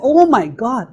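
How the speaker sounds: an exasperated "Oh my God" at the driver; mock road annoyance; exasperated groan. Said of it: "And then it's".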